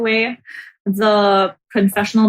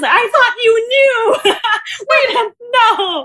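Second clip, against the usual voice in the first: laughing retelling; 14 semitones higher, slower than usual. Retold or imitated. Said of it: "She goes".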